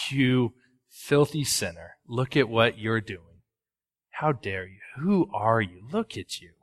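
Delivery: soft, low, contemptuous muttered scorn; condemning sneer. Retold or imitated. Pastor voices a heart condemning a sinner in a quiet, low sneer.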